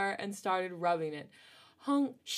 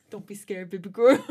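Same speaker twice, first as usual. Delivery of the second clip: laughing; 6 semitones higher, 6 dB louder than usual. Acted. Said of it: "I can't say this seriously".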